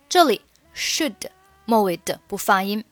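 ordinary delivery

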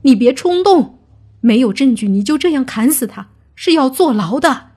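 high, loud pleading warning; urgent dissuasion. Acted. Clansman stopping a man with a blade, urgent. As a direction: talk him down urgently and forcefully.

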